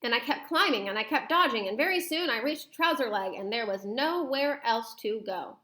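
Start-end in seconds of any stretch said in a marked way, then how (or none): none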